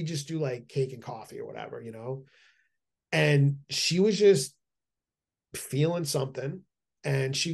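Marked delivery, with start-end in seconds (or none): none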